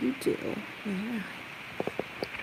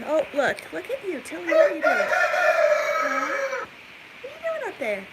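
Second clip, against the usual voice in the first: pet voice; 9 semitones higher, 4 dB louder than usual. Real, not acted. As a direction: coo at the bird like a pet. Talking to chicken in barn.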